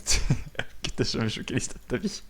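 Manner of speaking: laughing while talking; amused, breaking up into laughs; cracking up. Real, not acted. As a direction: talk through bursts of laughter. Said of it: "ça me fait trop marrer".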